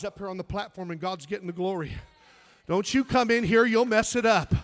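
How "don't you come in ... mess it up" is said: loud mock warning shout. Preacher shouting the big names away from the platform. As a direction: bellow it at the celebrities.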